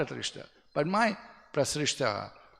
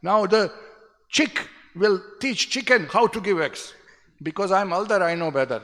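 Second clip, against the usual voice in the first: loud, high retort imitating an offended father; upset father; 7 semitones higher, 8 dB louder than usual. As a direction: act out the indignant elder scolding his son. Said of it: "He was very upset and finally said".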